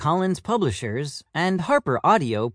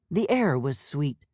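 ordinary delivery

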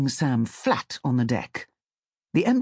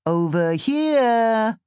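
high, loud, slow sing-song; sing-song call; 9 semitones higher, 5 dB louder, much slower than usual. A narrator's reading. First voice calls to Sam, sing-song. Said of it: "calls in a sing-song voice".

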